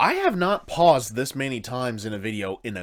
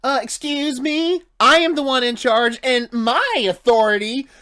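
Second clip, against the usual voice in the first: Doc doing Blissey's voice; 14 semitones higher, 9 dB louder than usual. Acted. Reactor imagining Doc in disguise demanding respect, in a high, loud imitation voice. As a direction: assert authority in a high put-on voice. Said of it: "picture Doc doing Blissy's voice".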